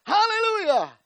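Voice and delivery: high, loud fervent cry; shouted hallelujah. Real, not acted. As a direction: cry it out in praise. Preacher exclaims at peak.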